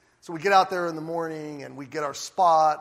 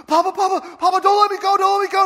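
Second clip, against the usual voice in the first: put-on panicked child's cry, much higher and louder; frantic child; 11 semitones higher, 11 dB louder than usual. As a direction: beg not to be let go, terrified. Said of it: "And he's frantic".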